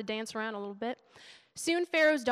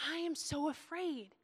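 slow, higher, softer mock whimper; retold tearful confession; 4 semitones higher, 5 dB quieter, slower than usual. Wife crying in the car confesses fear, retold tearfully. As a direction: re-enact a teary admission, drawn out. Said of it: "I was just crying".

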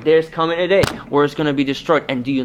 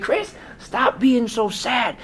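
high, slow, teasing widow; the widow's voice; 10 semitones higher, much slower than usual. Retold or imitated. Widow scolding the pastor for sounding sad, high and slow. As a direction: raise the voice up high for her, slow and chiding. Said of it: "She said".

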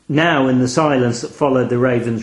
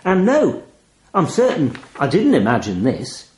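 usual speaking voice

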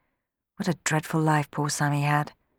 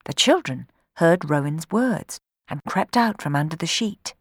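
ordinary delivery, nothing marked

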